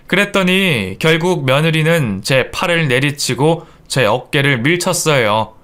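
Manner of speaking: plain reading voice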